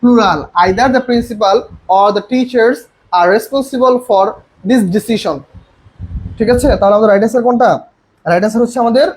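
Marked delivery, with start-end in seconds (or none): 0.6-5.4 slow dictation. Shown as a demonstration. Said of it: "verb হবে".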